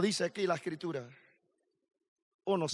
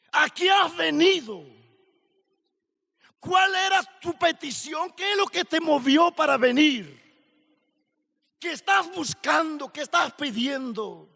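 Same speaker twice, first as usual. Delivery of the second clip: loud, raised-pitch altar call; preaching at full force; 5 semitones higher, 4 dB louder than usual. Acted. Preacher challenging the youth at camp, loud fiery altar call. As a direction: press the young listeners with fiery questions.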